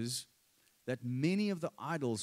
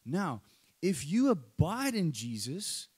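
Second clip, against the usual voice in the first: ordinary talk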